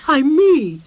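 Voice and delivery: high, loud distressed cry; unhappy cry. A narrator's reading. Moomintroll insists to his mother, unhappy. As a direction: cry out hurt. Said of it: "cried the unhappy Moomintroll".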